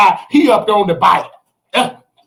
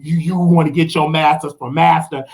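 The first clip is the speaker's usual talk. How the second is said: ordinary talk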